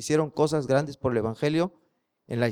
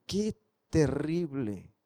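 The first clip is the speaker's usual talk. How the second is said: 1 asombro irónico; 8 dB quieter, much slower than usual. Acted. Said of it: "comentario irónico".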